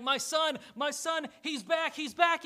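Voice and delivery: high, loud and excited, building as he runs; a father crying out with joy for his returning son; overjoyed father. Acted. Preacher voicing a father seeing his lost son, overjoyed.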